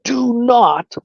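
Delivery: "do not" pushed high and heavy; emphasis on do not. Retold or imitated. Lawyer warning him, "do not" heavily stressed. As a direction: lean hard on "do not". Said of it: "now, I'm gonna emphasize this hugely".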